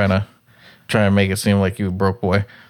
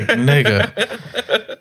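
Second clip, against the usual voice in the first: laughing retort; 5 semitones higher than usual. Retold or imitated. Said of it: "I was like".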